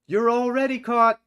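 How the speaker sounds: high, loud shout from the pillory; calling out. Acted. Prisoner in pillory answers the escapees, high loud shout. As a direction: yell it over to the prisoners.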